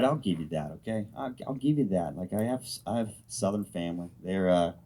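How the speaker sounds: ordinary talk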